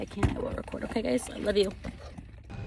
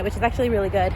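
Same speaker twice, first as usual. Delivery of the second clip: normal talking voice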